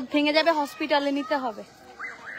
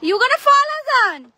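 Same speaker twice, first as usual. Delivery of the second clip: much higher, louder alarmed cry; alarmed shout; 8 semitones higher, 8 dB louder, slower than usual. Real, not acted. Mother crying out as her son nearly falls, alarmed and loud. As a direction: shout it as he slips.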